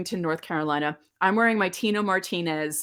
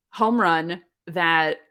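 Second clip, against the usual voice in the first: normal talking voice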